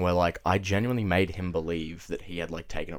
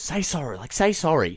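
higher stern aunt demand; stern auntie; 7 semitones higher than usual. Retold or imitated. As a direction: order him to apologise. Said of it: "my auntie goes".

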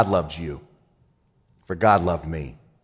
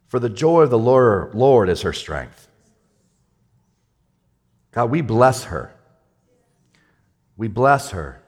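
slow, earnest prayer over her; fervent prayer; slower than usual. Acted. Pastor praying over the injured woman, earnest. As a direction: pray it out slowly, full of faith. Prays over a hurting family.

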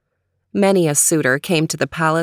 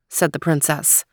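usual speaking voice

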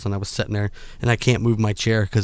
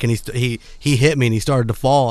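ordinary talk, nothing marked

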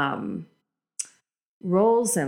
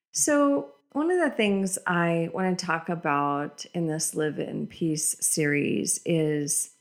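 usual speaking voice

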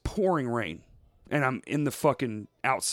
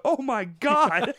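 slow, higher, louder gasp of horror; shocked cashier; 11 semitones higher, 5 dB louder, much slower than usual. Retold or imitated. Walgreens cashier seeing the bleeding hand, slow shocked gasp. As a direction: stretch it out, aghast. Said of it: "And she go".

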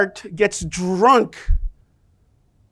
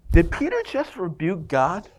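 ordinary talk

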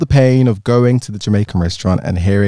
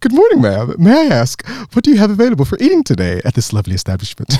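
overly polite voice; 9 semitones higher, faster than usual. Retold or imitated. Friend's overly polite restaurant request.

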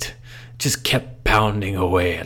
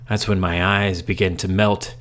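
ordinary delivery, nothing marked

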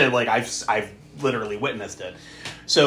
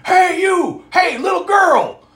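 high, loud shout drawn out at the end; creepy shout; 8 dB louder, slower than usual. Retold or imitated. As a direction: holler after the girl from across the street. Homeless man shouting at Tess.

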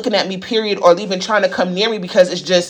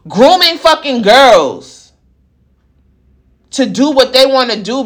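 angry; 4 semitones higher, slower than usual. Real, not acted. Slow, loud, disgusted.

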